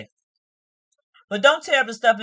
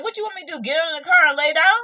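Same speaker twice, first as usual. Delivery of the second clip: incredulous; 7 semitones higher, 4 dB louder, faster than usual. Retold or imitated. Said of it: "he was like".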